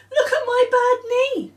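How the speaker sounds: very high, loud, crying whine; Claire's voice. Acted. Girl wailing to Nick about her knee. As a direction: wail it like a hurt child.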